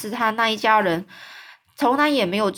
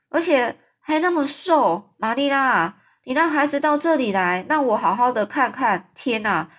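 a lightly voiced blunt neighbour, a bit higher; blunt Mrs Lynde; 6 semitones higher, 3 dB louder than usual. Storyteller voicing Mrs Lynde criticising Anne's looks, blunt and higher. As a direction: tactless gossip sizing up the child, mildly raised. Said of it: "他讲话是一个很直接的人".

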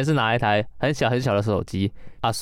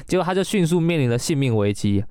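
normal talking voice

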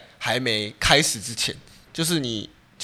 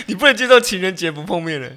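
laughing tease; 7 semitones higher, 3 dB louder than usual. Real, not acted. Teasing friend about Valentine's meeting.

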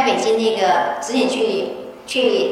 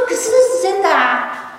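insisting; 7 semitones higher, slower than usual. Retold or imitated. Insisting slowly.

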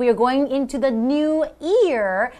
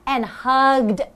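slow reading aloud; 2 semitones higher, slower than usual. Shown as a demonstration. Rereading the article line slowly.

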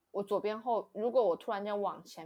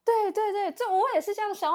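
eager agreement; 12 semitones higher, 8 dB louder than usual. Real, not acted.

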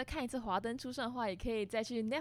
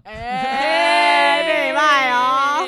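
loud; 3 semitones higher, 14 dB louder, slower than usual. Acted. Mock sign-off, loud goodbyes.

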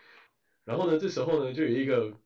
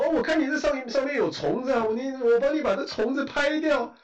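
higher, fast, innocent-sounding excuse; mock old man excuse; 11 semitones higher, 3 dB louder than usual. Imitating the old man caught stealing bags, fake-innocent. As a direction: play the old thief feigning helpfulness. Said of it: "然后说".

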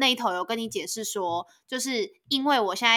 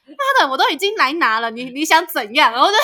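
high, loud hurt outburst; half-joking wounded protest; mock indignant rant; 7 semitones higher, 9 dB louder than usual. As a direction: vent the grievance loudly in mock anger. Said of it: "我就心里想说".

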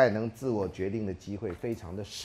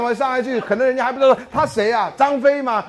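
normal talking voice

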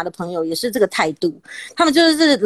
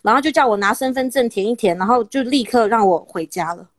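ordinary talk

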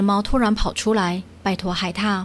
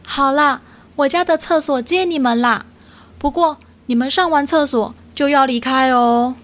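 higher, grudging otter giving in; reluctant; 5 semitones higher, 3 dB louder than usual. Acted. Otter grudgingly lending the cats his toilet, reluctant. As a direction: give in with a grudging sigh in the voice. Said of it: "心不甘情不愿地转过身来说".

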